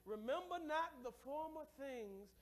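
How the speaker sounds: slow, weighty reading, each word leaned on; emphatic scripture reading. Acted. Pastor reads Isaiah to the church, slow and emphatic. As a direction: read it slowly like holy writ.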